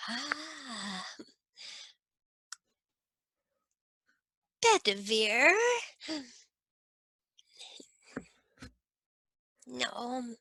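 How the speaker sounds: put-on a strange old hag's voice, slow with a cackle and whispers; Dame Ragnell. Dame Ragnell slyly naming her reward before the court. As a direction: savour every word like a sly crone.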